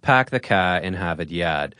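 Boston accent, every R dropped, "Harvard Yard" as "Hahvahd Yahd"; thick Boston accent with long flat ah sounds; Boston accent, say "pack the car" with no R at all. Shown as a demonstration. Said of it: "illustrates the stereotypical Boston accent".

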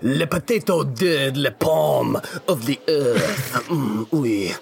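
slow, grandiose fake French; mock French accent. Mock French dish name.

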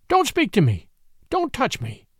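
high-pitched, recoiling outcry; frantic exclamation. A narrator's reading. Rachel shrinking back from all of them, high frantic exclamation. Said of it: "she exclaimed, shrinking back from all of us".

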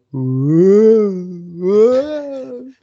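drawn-out swooping whoa, loud and rising; acted-out wheeling whoop; rollercoaster whoa. Shown as a demonstration. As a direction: ride the bumps with a long playful whoa. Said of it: "in my head, I go".

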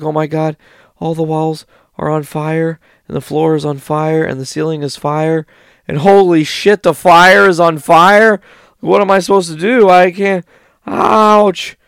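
high, loud hammed-up panic; mock panic. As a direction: freak out as the house burns. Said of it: "And they're like".

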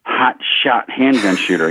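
slow, drawn-out emphasis on each word; exaggerated retelling. Director sizing up the shooting trainer, exaggerated slow retelling. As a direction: stretch it out, word by word, for effect. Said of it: "He didn't say it like that".

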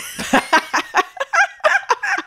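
high, helpless burst of laughter; real high-pitched cackling laugh; big laugh. Real, not acted. Bursts out laughing at friend's answer.

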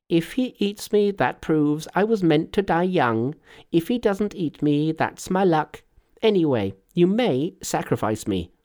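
high, lilting Monkey voice; carefree Monkey. A narrator's reading. Monkey shrugging off being eaten, breezily fatalistic. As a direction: reason it out airily in a high pitch.

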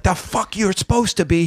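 high, belligerent put-on voice; Sandman. Drunk wrestler who won't let the newcomer be, high belligerent rant. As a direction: push the pitch up and get in his face. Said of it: "this is me doing my Sandman".

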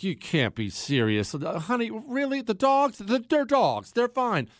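mock the wife's worry, rising in pitch; incredulous eye-roll. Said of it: "that kind of rolled eyes look like".

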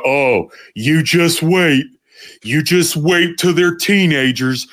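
slow, drawn-out ominous warning; knowing parents. Retold or imitated. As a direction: drag it out like a smug veteran parent. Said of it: "and people go".

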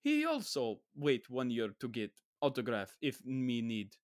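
halting broken English in a mock Slavic accent; Tom Hanks. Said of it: "this weird broken English, Bulgarian, Russian accent".

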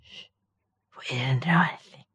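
soft secretive whisper. Pretend. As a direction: hush it like sharing a secret. Telling a secret, hushed.

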